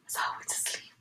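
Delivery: breathy, unvoiced whisper. Real, not acted. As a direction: drop to a real whisper, as if sneaking off. Whispered aside after the sigh.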